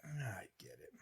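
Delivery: whispered resignation; whispering. Real, not acted. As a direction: breathe it out in a whisper. Resigned muttering.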